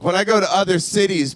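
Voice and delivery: ordinary talk, nothing marked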